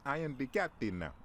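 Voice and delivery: captain quote. Retold or imitated. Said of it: "the Roni kicked in the door and said".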